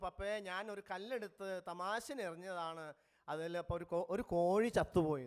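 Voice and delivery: loud, low blurt, building to the end; boy confessing to his dad, loud blurt; blurted confession. Retold or imitated. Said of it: "then blurted out by saying".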